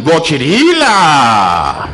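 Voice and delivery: valet's drawn-out greeting. Retold or imitated. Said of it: "me dice el vale parking".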